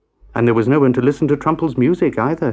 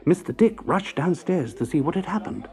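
ordinary delivery

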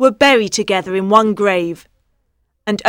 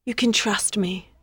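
softer, a gentle promise; quietly; 8 dB quieter than usual. Acted. Queen reassures her husband, said softly. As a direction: say it under your breath to the king. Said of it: "said Queen Isolde quietly".

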